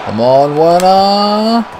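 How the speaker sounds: high rising call of "Werner"; urging cheer. Real, not acted. Gamer willing his striker to score, high rising call. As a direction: pull the name up, willing him on.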